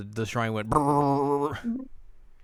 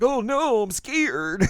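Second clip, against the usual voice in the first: much higher, louder squeal; hammed-up damsel panic; mock fright; 12 semitones higher, 5 dB louder, much slower than usual. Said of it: "and she's like".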